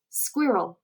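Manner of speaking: ordinary delivery, nothing marked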